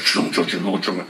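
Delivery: rushing "shum, shum" shaker noise; vocal imitation of rattling ball bearings; imitated rain stick rattle. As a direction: mimic a heavy rain stick being shaken. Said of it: "It goes".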